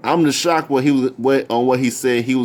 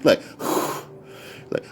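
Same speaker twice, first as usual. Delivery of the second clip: imitated heavy breathing; 8 dB quieter than usual. Annoyed listener mimicking a rapper's breathing, heavy puffs. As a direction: puff out breaths, mimicking him. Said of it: "all I hear is that breathing like this".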